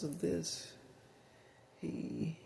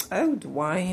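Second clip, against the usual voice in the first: high, louder swooping call of a name; Willie Gilbert; 6 semitones higher, 7 dB louder than usual. Acted. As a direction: call out to Dwayne in a high character voice. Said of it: "like Willie Gilbert".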